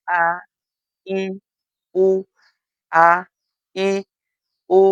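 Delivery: আ, ই, উ sounded slowly one by one, each vowel drawn out; Arabic vowels a i u. Shown as a demonstration. Teacher sounding the three vowels together for learners, slow and distinct. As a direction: model আ then ই then উ as distinct Quranic vowels. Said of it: "একসাথে উচ্চারণ".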